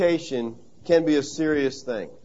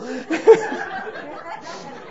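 laugh; 8 semitones higher than usual. Real, not acted. Laughing at the story.

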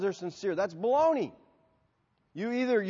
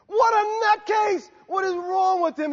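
high, loud incredulous shout; shocked outburst; 13 semitones higher, 7 dB louder, slower than usual. Pretend. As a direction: yell in disbelief at a madman. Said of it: "Would you leave here and say".

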